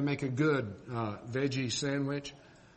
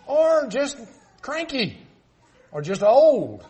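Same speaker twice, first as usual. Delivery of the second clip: higher, much louder punchline; emphatic jab; 9 semitones higher, 9 dB louder, slower than usual. Real, not acted. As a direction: land the jab hard. Mocking list of husbands' faults.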